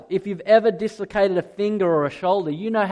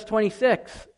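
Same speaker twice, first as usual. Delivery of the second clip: "twenty-six" with a light New Zealand vowel; a touch of New Zealand in "twenty-six"; slight New Zealand accent; 3 semitones higher, 4 dB quieter than usual. Real, not acted. Preacher citing "twenty-six" to the congregation, slight New Zealand accent. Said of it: "a little bit of a New Zealand accent".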